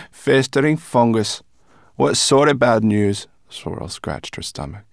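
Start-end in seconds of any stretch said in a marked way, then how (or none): 0.1-3.3 higher, loud and teasing; cheeky creature swearing; brownie voice. A narrator's reading. Brownie teases.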